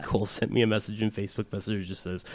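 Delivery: ordinary delivery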